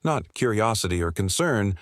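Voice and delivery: ordinary delivery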